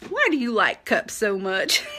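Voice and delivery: put-on a child's question in a light Southern accent, half laughing. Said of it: "Not with a Southern accent".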